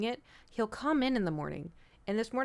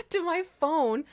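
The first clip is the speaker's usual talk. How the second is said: laughing; 9 semitones higher, slower than usual. Real, not acted. Cat headbutting phone.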